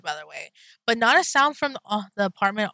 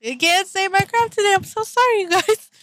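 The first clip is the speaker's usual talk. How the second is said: high, giggling sorry after fumbling words; giggly apology; 10 semitones higher, 4 dB louder than usual. Real, not acted. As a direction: laugh through the apology, voice way up. Tongue-tied, giggling apology.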